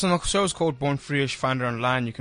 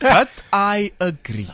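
slow, higher-pitched mock Dutchman accent; 5 semitones higher, slower than usual. Comedian agrees with host about the lion movie, hammy Dutchman accent. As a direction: put on a drawn-out Dutchman accent. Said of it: "your best Dutchman accent".

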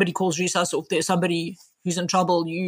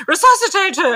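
much higher, louder barked command; retold shout, pitched way up; shouted order; 12 semitones higher, 7 dB louder than usual. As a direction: yell the order at her. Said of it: "somebody sort of shout at me".

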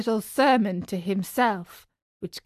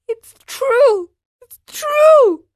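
very high, wailing puppet in tears; high-pitched sobbing confession; tearful cry; 7 dB louder, slower than usual. A narrator's reading. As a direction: wail it loudly, crying. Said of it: "cried Pinocchio in tears".

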